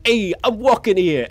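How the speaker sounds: loud, high-pitched mock Boston accent. Shown as a demonstration. Streamer showing viewers his Boston accent, loud and hammed-up. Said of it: "a Boston accent is just like".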